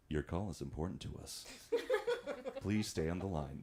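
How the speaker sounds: a hint of a smooth automated phone recording; phone hold message voice. Shown as a demonstration. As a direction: read it like a polite hold message. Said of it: "That's me".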